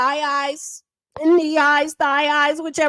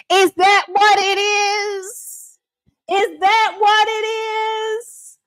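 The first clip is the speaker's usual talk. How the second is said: higher, slow, drawn-out taunting question; mock interrogation; 7 semitones higher, much slower than usual. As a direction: stretch the question to mock him. Mocking repeated interrogation.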